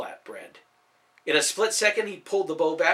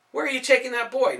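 much higher, louder confronting call; demanding challenge; 11 semitones higher, 6 dB louder than usual. Acted. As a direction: demand an answer from the baker. Hero confronts baker on street.

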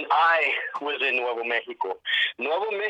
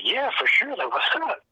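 eager; 7 semitones higher than usual. Retold or imitated. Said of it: "And I was like".

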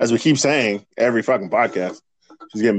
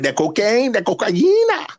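higher, rolled Spanish-style cocaina; mock Spanish accent; 10 semitones higher than usual. Cohost joking about drugs in a mock Spanish accent. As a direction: say cocaina with a hammed-up Spanish lilt.

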